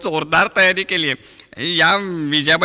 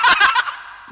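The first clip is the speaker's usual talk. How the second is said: shrieking high laugh; 4 dB louder than usual. Acted.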